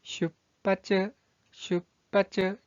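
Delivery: 슈빠츠 slowly, with a held stop before 빠; pronunciation demo. Shown as a demonstration. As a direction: model 슈빠츠 broken after 슈. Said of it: "이 한자의 발음은".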